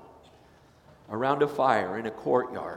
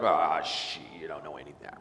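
grumbling dismissal; 8 semitones lower than usual. Retold or imitated. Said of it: "You're like".

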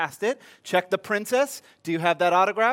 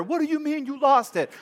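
calmly screaming; 7 semitones higher than usual. Retold or imitated. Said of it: "calmly screaming at her".

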